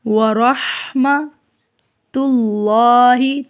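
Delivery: very slow ورحمت اللہ with a heavy lam; slow careful model. Shown as a demonstration. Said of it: "اللہ کا لام پر پڑا جائے گا". (آہستہ ورحمت اللہ، لام پر)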